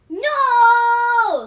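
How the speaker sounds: put-on piercing high scream of a furious toddler. A narrator's reading. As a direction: scream it like a tantrum. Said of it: "screamed Terrible Jace".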